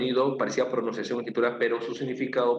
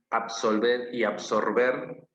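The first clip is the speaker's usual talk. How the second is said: "absolver" y "absorber" muy despacio, marcando la v y la r; pronunciación lenta; much slower than usual. Shown as a demonstration. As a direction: separa bien "absolver" de "absorber", lento. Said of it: "palabras que tienen parecido sonido". (contrastando "absolver y absorber" despacio para la clase)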